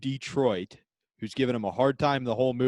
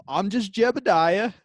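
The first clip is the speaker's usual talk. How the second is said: high, slow character voice; naive Jebediah; 7 semitones higher, 4 dB louder, slower than usual. Acted. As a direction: play an innocent, pitched up and drawn out.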